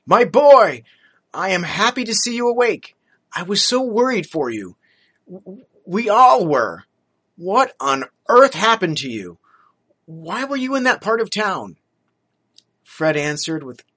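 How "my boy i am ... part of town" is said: raised, loud, anxious father's voice; a father fussing over his hurt son; relieved father, louder and higher; worried father. Acted.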